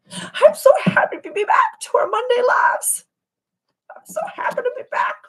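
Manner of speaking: high, fast, squealing happy voice; excited squeal. Real, not acted. Host greets her community with a high delighted squeal. Back to Monday lives.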